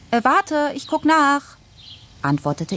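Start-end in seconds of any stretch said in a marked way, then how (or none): 0.1-1.6 put-on pleading girl's voice. Daughter fakes checking her phone.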